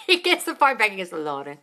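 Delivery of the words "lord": imitated Italian-accented English, word by word. Fiancé baffled after talking to her brother, Italian accent. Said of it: "talking to him in an Italian accent".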